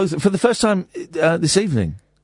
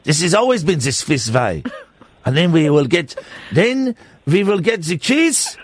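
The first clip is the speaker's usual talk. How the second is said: mock-solemn Swiss accent; silly, earnest Swiss villager's accent; 3 semitones higher than usual. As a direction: proclaim in a hammed-up Swiss accent. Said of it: "It's a Swiss accent".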